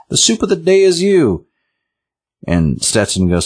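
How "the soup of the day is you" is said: high, hammed-up villain announcement; theatrical devil chef, pitched way up; horrible devil chef. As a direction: proclaim it like a mock-evil chef. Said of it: "this horrible devil chef and says".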